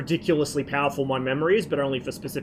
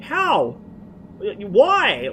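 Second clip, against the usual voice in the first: high, louder stunned disbelief; stunned old man; 6 semitones higher, 5 dB louder than usual. Retold or imitated. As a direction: imitate his shocked How, Why. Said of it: "he was fucking stunned".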